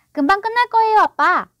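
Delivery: put-on high, loud, chirpy child's voice; cheerful boy. A narrator's reading. John cheering his father on about the medicine, cheerful. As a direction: pipe up brightly as an eager son. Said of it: "존이 쾌활하게 말했다".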